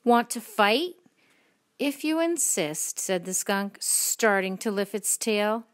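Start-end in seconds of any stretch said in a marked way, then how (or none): 0.0-1.0 loud, slow, rising challenge; grouchy challenge. A narrator's reading. Grouchy ladybug picking a fight, loud rising challenge. 1.8-3.0 high, lilting storybook reply; obliging reply. A narrator's reading. Creature accepting the ladybug's challenge, high and lilting.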